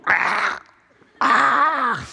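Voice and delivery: imitating a weak, rising kitten croak; sick kitten croak. Describing the sick kitten, imitating its croak. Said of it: "He was going".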